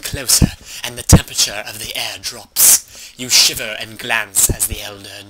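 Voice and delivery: dramatic fantasy narrator voice; story narration. Acted. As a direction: read like an epic tale.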